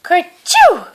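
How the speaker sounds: loud, high mock sneeze. A narrator's reading. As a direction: burst out a big high kerchoo. Fur child sneezes again.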